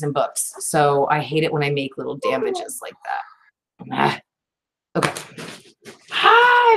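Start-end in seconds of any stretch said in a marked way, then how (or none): 6.1-6.8 high, sung-out squeal of anticipation. Real, not acted. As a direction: squeal an excited ah. Opening the disc case.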